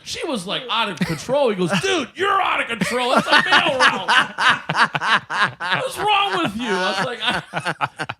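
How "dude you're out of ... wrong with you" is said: high, loud laughing shout; mock yell. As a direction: yell at your friend through laughter. Bubba yelling at him.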